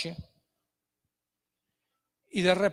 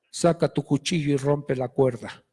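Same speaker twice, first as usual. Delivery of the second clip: voice of God; 8 semitones lower than usual. Acted. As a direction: speak as God from above, deep and resonant. God commands the climber.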